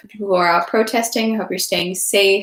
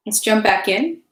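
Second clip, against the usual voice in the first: ordinary delivery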